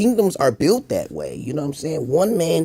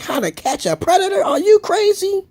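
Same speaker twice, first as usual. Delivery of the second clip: higher, louder, slowed disbelief; incredulous outburst; 14 semitones higher, 5 dB louder than usual. Retold or imitated. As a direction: throw up your hands at it. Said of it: "I was like".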